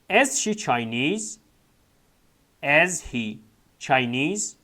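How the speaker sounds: drawn out with a rising end; very slow model reading. Shown as a demonstration. Teacher modelling the question for students, very slow. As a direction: say it slowly for beginners. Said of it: "teacher models textbook questions".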